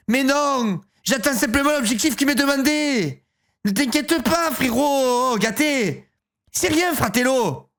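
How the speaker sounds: hammed-up Marseille accent. Sister reassuring her brother, hammed-up Marseille accent. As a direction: lay the Marseille accent on thick. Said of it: "Évidemment qu'elle est de Marseille".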